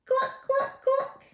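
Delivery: very high, short hen clucks; clucking hen. Acted. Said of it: "and the hen said".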